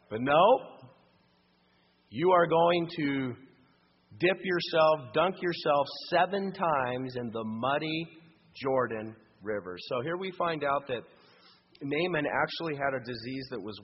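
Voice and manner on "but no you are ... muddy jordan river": loud, slow, driving each word; forceful preaching. Acted. Preacher to congregation, loud and slow. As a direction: press it home like a verdict.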